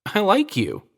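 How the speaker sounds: chirpy, disarming cheer; brightly. A narrator's reading. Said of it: "she said brightly".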